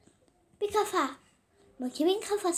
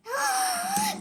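high gasp. Pretend. Princess toy gasps happily.

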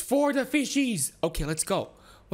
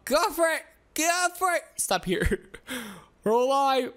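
ordinary talk

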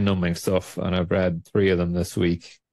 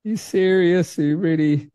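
mock disbelief; 9 semitones higher than usual. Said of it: "And I'm like".

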